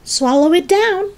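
high eager urging; crying out. A narrator's reading. George pushing the spoon in, crying out. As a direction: urge her on in a high voice. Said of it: "George cried out".

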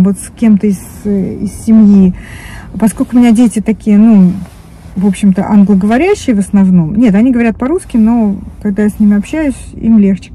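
usual speaking voice

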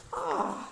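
sharp high gasp of surprise; the animals startled, gasping high. A narrator's reading. Animals spot the missing underpants, high gasp.

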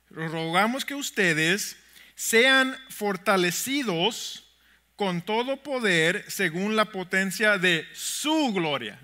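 very slow, deliberate verse reading; slow scripture reading. Shown as a demonstration. Pastor reading the verse so they notice something, slow and deliberate. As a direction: read it out slowly, phrase by phrase. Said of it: "lo quiero leer un poco más lentamente".

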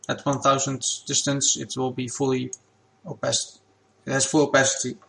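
ordinary talk

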